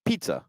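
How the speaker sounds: "pizza" as "PEET-sa". Shown as a demonstration. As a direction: sound the hidden T in "pizza". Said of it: "You don't have a problem saying".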